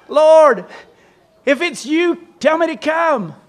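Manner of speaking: high, loud, eager call across the water; eager Peter calling out. Acted. Peter in the boat calls out to Jesus on the water, high and loud.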